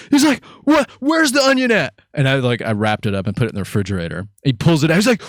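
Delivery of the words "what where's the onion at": upset friend. Retold or imitated.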